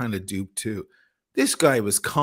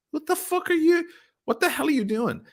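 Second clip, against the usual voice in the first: retold disbelief. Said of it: "I'm like".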